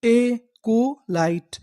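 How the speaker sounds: very slow, drawn out; slow teaching model of the word; slow syllable-by-syllable reading. Shown as a demonstration. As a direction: sound out एकोलाइट slowly for students. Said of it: "तो पूरी meaning क्या बनी?".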